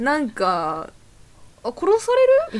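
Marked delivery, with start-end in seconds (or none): 1.6-2.5 mock alarmed squeak. Joking about period pain.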